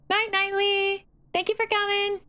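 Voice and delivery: bright high goodbye; cheerful high farewell. Real, not acted. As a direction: sing out the thanks. Farewell to raider.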